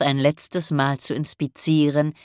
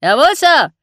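high, loud shouted reply; crisp sailor's holler; calling down; 12 semitones higher, 9 dB louder than usual. A narrator's reading. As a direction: answer the captain at a shout from aloft. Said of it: "rief Pinsley hinunter".

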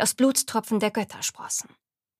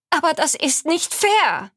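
upset protest; 6 semitones higher, 5 dB louder than usual. Acted. Granddaughter protests to grandmother.

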